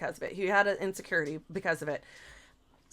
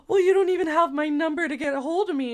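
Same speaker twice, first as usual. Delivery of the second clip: higher, rushed mock-tearful protest; mock crying; 6 semitones higher, 4 dB louder, faster than usual. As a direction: imitate a hurried, weepy excuse. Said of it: "she's just crying and all this shit".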